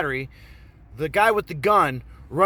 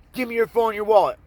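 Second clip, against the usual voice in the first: high, loud, fast mock demand; acted robber's threat; 6 semitones higher, 5 dB louder, faster than usual. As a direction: rap out the order as an armed mugger. Armed robber's final demand.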